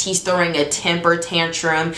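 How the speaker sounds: usual speaking voice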